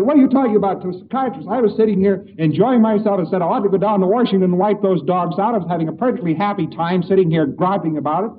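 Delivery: rant, offended and griping; an angry man offended at being called unfit for Washington; indignant angry man. Retold or imitated. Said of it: "And the fellow said".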